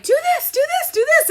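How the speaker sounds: very high, chanted commands; mimicked bossy instructor. Yoga teacher piling up steps on beginners, mimicked. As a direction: rattle off orders in a high mock voice.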